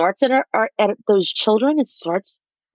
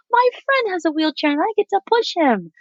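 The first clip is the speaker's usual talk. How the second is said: put-on child's eager voice, much higher; eager little boy explaining, pitched up; lightly voiced excited child; 10 semitones higher than usual. Mother retells her son's eager excuse, high child voice.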